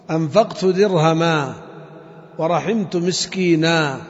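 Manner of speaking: slow, the tanween of "درهما" and "مسكينا" turned into a long a; pausal form demo. Shown as a demonstration. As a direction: read slowly, ending on a long a. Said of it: "تقول في الوقف". (نطق "درهما" و"مسكينا" بألف ممدودة ببطء)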